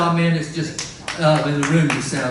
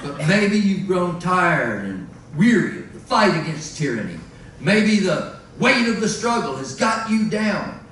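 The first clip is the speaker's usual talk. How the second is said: loud hammed-up infomercial pitch, higher and louder; mock commercial; 4 semitones higher, 3 dB louder than usual. Singer reading a fake sponsor ad to the crowd, booming salesman voice. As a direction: sell the anti-fascist coffee like a TV ad. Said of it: "I've had to take on a corporate sponsor".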